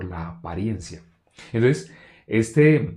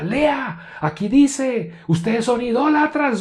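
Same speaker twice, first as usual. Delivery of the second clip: high, shouted accusation; pitched-up mock yell; retold shouting; 4 dB louder, much slower than usual. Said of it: "o les gritaba cosas".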